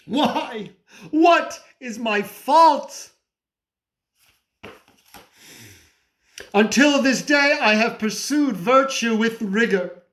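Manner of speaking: high, pleading outcry; anguished protest. Acted. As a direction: plead your case to God in anguish. Protests his innocence to God.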